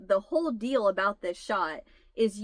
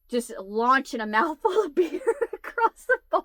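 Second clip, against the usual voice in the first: high, loud, giggling through the words; laughing; 6 semitones higher, 4 dB louder than usual. Real, not acted. Describing the tiny bartender to viewers, laughing hard. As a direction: let laughter take over.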